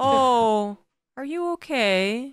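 high, slow fussing concern; mock worried coddling. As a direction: fuss over them like a nervous parent.

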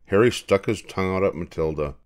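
ordinary delivery, nothing marked

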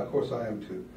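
ordinary talk, nothing marked

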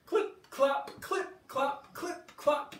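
high, loud clip-clop chant; clip-clop sounds. Acted. Storyteller making hoof sounds for kids, high and loud. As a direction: sing out the hoofbeats brightly. Hooves sound effect for children.

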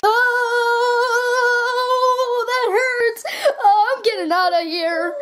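high, crying robot voice; wailing robot in pain. Acted. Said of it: "I sound like a human again".

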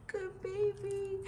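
cooing praise. Real, not acted. Praising the dog.

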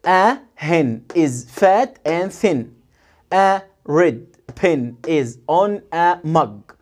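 very slow, word by word; slow final read. Shown as a demonstration. Teacher reading all the sentences slowly to the class. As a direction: read each sentence slowly, one last time. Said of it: "هقولهم آخر مرة".

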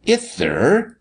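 put-on high, lisped child's answer; lisping little boy. A narrator's reading. Sammy answering the blustering doctor, lisping little boy. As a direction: lisp it in a small boy's high voice. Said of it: "and lisped that he was better".

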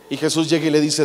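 ordinary talk

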